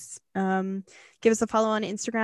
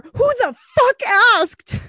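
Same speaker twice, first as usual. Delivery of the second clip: high, loud, slow mock outrage; mocking outburst; 11 semitones higher, 8 dB louder, much slower than usual. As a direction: drag it out in hammed-up scorn. Mocking her friend's story aside.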